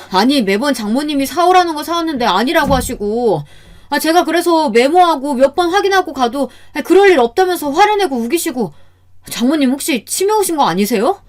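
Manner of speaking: high-pitched, exasperated grievance; angry complaint. A narrator's reading. Son-in-law airing grievances to mother-in-law, angry complaint. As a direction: pour out pent-up frustration, pitch climbing. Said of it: "제게 화를 낸 겁니다".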